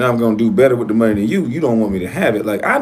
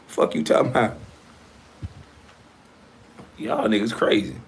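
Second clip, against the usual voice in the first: ordinary delivery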